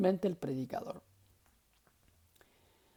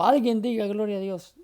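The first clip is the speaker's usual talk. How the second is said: louder, higher rallying call; emphatic pulpit call; 5 semitones higher, 7 dB louder than usual. Retold or imitated. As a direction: rouse the congregation like a preacher. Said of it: "bueno para darle énfasis".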